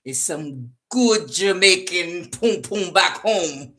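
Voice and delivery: put-on slow, relishing Jamaican accent. Reader as Jamaican rejecting a lover, slow boastful Jamaican accent.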